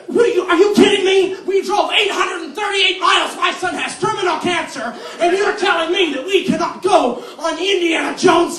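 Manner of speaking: loud, high, raging yell; furious grandma. Retold or imitated. Grandma rages at the ride worker, loud and high.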